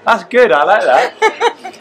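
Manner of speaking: laughing, high-pitched and slow; amused approval, broken up by laughing. Real, not acted. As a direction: draw it out through real laughter. Laughs at the interviewer's joke.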